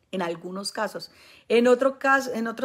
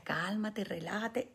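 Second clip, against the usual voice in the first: demon's hushed warning; 8 dB quieter, slower than usual. Retold or imitated. Demon warning its host, softer and hushed. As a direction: hush him like a demon afraid of being caught. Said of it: "Decía".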